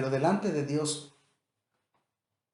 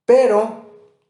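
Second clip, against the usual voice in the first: a sudden loud "Pero"; loud emphatic but; 5 semitones higher, 13 dB louder than usual. Shown as a demonstration. Preacher reading Bible verse to congregation: loud emphatic "Pero". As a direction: hit "Pero" hard and loud.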